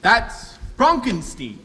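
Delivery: That's Frankenstein said as Fronk-en-steen, broad o and long ee; slow, pointed That's Frankenstein as Fronkensteen; corrected pronunciation. Acted. Correcting the messenger's reading of his name, Frankenstein as Fronkensteen. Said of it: "it's pronounced Frankenstein".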